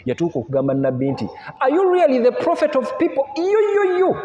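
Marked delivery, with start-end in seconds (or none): 1.6-3.3 high, mocking jealous demand; jealous wife taunting her husband; jealous challenge. 3.4-4.2 high mocking yo yo yo; mock jeer in a raised voice. Mocking jealous exclamation.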